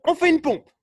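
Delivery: loud, high coaching shout; called-out command. Real, not acted. Coach orders the class to do a push-up, loud command. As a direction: call the order out to the group.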